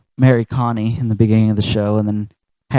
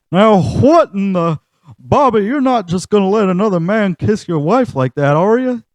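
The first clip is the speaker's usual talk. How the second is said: Hank Hill; 9 semitones higher than usual. Retold or imitated. Hank freaking out at Bobby over the kiss, high outraged.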